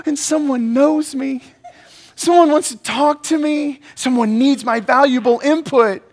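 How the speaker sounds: high, gushing self-important delight; gasp, then gush in a high voice as if flattered; mock thrilled. Acting out delight at receiving a text.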